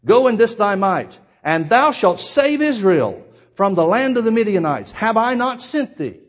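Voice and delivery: loud, slow commanding proclamation; slow, loud divine commission; booming voice of God. Acted. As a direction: declare it slowly with full force. God's command preached.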